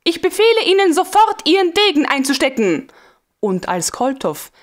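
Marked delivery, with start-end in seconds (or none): 0.0-2.9 high, fast, sharp command; commanding order. A narrator's reading. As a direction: order him at once. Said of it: "rief sie mit Kommandoton".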